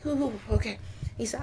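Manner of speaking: ordinary talk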